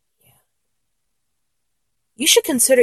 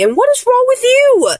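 flustered disbelief; 12 semitones higher than usual. Acted. Flustered, turns blame on listener.

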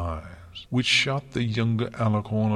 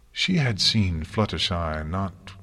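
plain reading voice

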